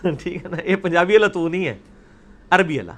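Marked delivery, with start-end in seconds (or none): none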